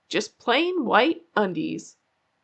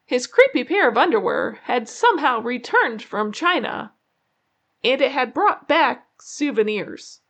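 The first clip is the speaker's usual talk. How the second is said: usual speaking voice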